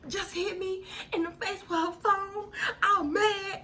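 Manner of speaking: high, tearful complaint; upset and crying on the phone; telling her sister, high and tearful. Retold or imitated. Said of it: "I'm crying".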